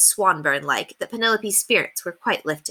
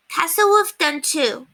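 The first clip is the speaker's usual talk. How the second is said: put-on little child voice; 10 semitones higher, slower than usual. A narrator's reading. Child Cassiopeia announces drawing.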